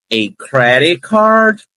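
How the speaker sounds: "A credit card." slow, with a clear English R. Shown as a demonstration. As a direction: stretch "credit card" showing the R. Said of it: "com R que faz esse som". ("A credit card." com R de inglês)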